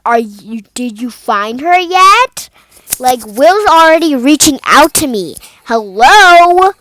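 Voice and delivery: impatient loud questions building to a shout; anxious demand. Acted.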